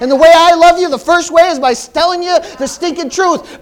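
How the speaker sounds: high, loud, driving sermon delivery; preaching at full force. Real, not acted. Preacher telling the congregation the stinking truth, full-force preaching.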